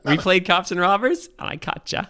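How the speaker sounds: high sing-song, fading to a whisper; childish mock cop. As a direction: tease like a kid who won a game. Cop voiced, mocking.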